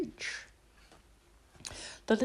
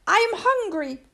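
little princess voice; 12 semitones higher, 3 dB louder than usual. A narrator's reading. Princess announces hunger.

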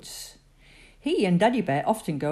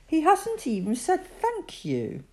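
inner thought; 12 semitones higher than usual. Acted. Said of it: "thought Teddy".

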